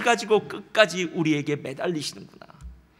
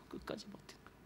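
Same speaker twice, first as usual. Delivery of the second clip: whispered echo; 25 dB quieter than usual. Shown as a demonstration.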